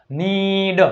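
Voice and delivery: નીડ with a long, held "ee". Shown as a demonstration. Teacher showing double E sound, long ee. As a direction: stretch the vowel of નીડ into "neeed". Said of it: "ઈ, એટલે કે દીર્ઘાય".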